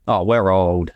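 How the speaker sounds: ordinary talk, nothing marked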